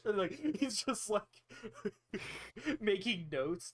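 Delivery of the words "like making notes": ordinary delivery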